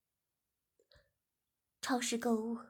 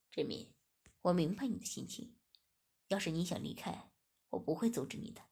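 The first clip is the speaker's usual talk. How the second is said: a low, measured man offering her freedom; lower, calm master voice; 5 semitones lower than usual. A narrator's reading. Master to his servant girl, offering release, low and calm. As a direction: voice the master lower, steady and understanding.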